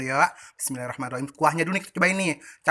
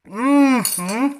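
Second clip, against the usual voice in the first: loud delighted mmm while chewing; savoring hum; 8 semitones higher, 6 dB louder than usual. Real, not acted. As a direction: hum with pleasure at the bite. First bite of the cake. (gumaman nikmat)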